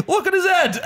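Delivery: high, laughing quoted jeer; laughing imitation. Retelling client showing daughter his head, high laughing imitation. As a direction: mimic the client through laughter.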